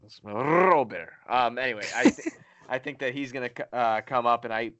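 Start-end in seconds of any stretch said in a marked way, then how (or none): 0.1-1.1 Robert with a strong trilled R; rolled r. Shown as a demonstration. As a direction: roll the R in Robert. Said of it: "Pretty good".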